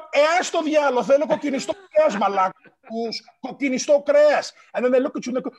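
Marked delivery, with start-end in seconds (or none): 0.1-4.5 loud, pushy demand for red meat. Retold or imitated. Kid demanding red meat from parents, loud and pushy. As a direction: bark it like a fed-up kid.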